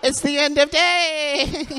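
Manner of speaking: high, slow dramatic pronouncement; mock dramatic villain. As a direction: intone it like a doom-laden movie villain. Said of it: "You're like".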